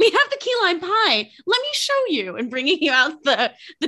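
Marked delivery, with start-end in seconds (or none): 0.0-2.4 much higher, sing-song server voice; waitress presenting the dessert tray, much higher bright voice; diner waitress. Pretend. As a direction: sell the pie like a cheery waitress.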